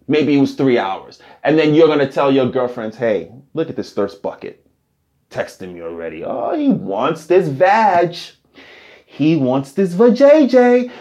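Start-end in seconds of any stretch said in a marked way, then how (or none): 3.0-6.2 put-on low, hushed sneer to the girlfriends; hushed gossip to her girlfriends about him, mocking. Girlfriend sneering to friends about the thirsty texter, hushed and low. 6.2-10.9 high, slow mock girly voice; mocking girlfriend. Girlfriend gloating to friends about the texter, high, slow mock voice.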